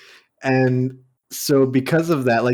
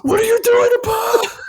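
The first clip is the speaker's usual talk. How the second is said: very high, louder, crying shriek; mock mom shrieking at the bloody tub; imitating panicked mom; 5 dB louder than usual. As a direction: wail it in horror. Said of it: "And she goes".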